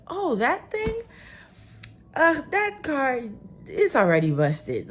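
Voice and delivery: high laughing shrug-off; amused carefree neighbour. Retold or imitated. Neighbour shrugging off dented truck.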